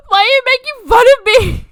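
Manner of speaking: mock his hurt complaint; lightly voiced whiny ex-boyfriend. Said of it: "And he was like".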